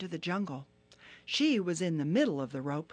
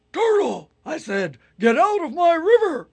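even louder, furious hippo roar; angrier Hippo; 12 semitones higher, 15 dB louder than usual. Acted. Hippo repeating his order, now roaring angrily. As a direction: blast the order like a raging hippo. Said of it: "Turtle was getting angry".

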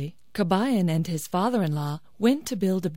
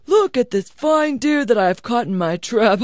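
louder, higher, gloating voice; boastful father-in-law; 5 semitones higher, 6 dB louder than usual. Acted. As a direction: show off your catch. Father-in-law boasts of stolen deer.

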